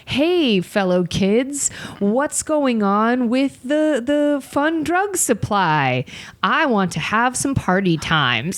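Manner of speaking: an undercover cop badly pretending to be young; fake-cool cop. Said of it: "the tweets are like".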